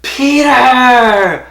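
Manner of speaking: loud, high, stretched-out cry of the name; imitated overjoyed welcome; delighted greeting. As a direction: shout it out high with a huge smile. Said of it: "has this huge smile".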